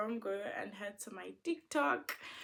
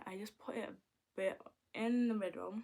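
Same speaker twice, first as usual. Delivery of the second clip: ordinary talk, nothing marked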